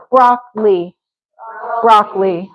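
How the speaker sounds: "broccoli" squeezed to BROCK-lee; fastest form. Shown as a demonstration. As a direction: skip the middle vowel of broccoli. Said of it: "going for the fastest way possible".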